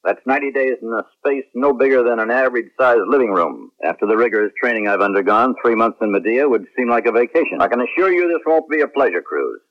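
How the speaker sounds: usual speaking voice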